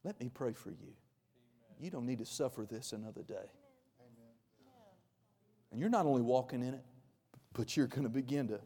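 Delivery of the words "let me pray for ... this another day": tender Jesus. Retold or imitated. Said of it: "he said".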